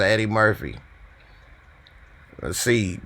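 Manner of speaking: normal talking voice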